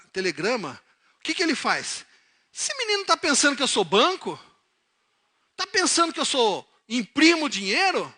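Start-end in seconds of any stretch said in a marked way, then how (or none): 2.5-4.4 loud, high, outraged question; acted outrage in the preacher's usual accent; indignant father. Father reacting to his son's telegram, indignant. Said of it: "ele não falou assim". 5.6-8.1 high, loud, exasperated outburst; indignant father. Acted. Father fuming over a request for money, indignant. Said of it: "ele não falou assim".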